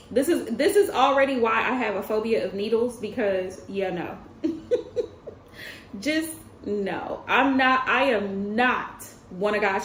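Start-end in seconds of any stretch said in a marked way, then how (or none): 4.4-5.3 high, breathy laugh; rueful little laugh. Real, not acted. Laughing about needle phobia.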